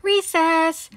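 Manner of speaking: loud, high happy shout. Acted. Pete shouts to everyone as the bell rings, loud high shout. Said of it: "Pete shouts as the bell rings".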